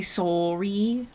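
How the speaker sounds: drawn-out "sorry" with a rounded SOH; Canadian "sorry". Shown as a demonstration. Streamer repeating the demo for chat, slow Canadian "sorry" as SORE-ee. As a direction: say "sorry" slowly as SORE-ee, lips pursed. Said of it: "my lips get pursed more".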